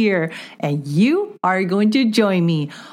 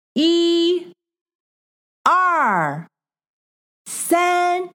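high sing-song counting; 7 semitones higher, much slower than usual. Acted. Counting for child learner.

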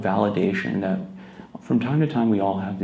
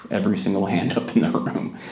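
usual speaking voice

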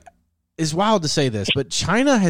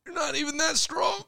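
much higher, softer put-on sneer; mock pickup artist; 6 dB quieter than usual. Dying pickup artist insulting his attacker, high mock sneer. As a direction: neg him in a squeaky cartoon voice. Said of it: "he's just like".

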